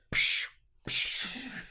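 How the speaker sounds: two hissy "psh" impact sounds; imitated blow noises. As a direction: mimic the blows with your mouth. Imitates hatchet blows landing.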